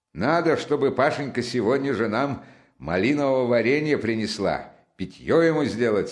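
lightly animated friend taking charge; a touch of brisk, eager instruction; lively Razumikhin. Acted.

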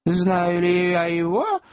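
high, drawn-out lady's voice; hesitant lady, pitch lifting at the end; hesitant echo question. A narrator's reading. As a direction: repeat the question slowly, unsure. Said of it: "нерешительно переспросила леди средних лет".

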